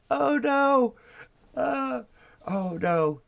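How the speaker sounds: high-pitched, hammed-up "oh no"; mock dismay. Reacting to another liberal in bubble glasses, mock dismay. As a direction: wail it in mock horror. Mocking the person in clip.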